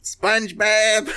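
drawn-out cartoon Texan call, high; Sandy Cheeks impression. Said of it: "Now here's a good Sandy".

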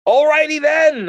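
high, loud, drawn-out exclamation; hammed-up startled acceptance; awkward retold reaction. Acts out reaction to the find.

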